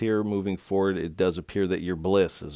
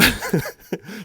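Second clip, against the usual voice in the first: squealing laugh, much higher and louder; high burst of laughter; 13 semitones higher, 4 dB louder than usual. Real, not acted. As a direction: laugh out loud at the joke. Laughs at her own joke.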